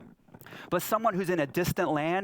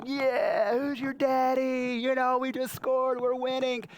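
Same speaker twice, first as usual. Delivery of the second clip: gloating footballer; 11 semitones higher, slower than usual. Retold or imitated. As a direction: crow like a player who just scored. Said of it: "guys will score a goal".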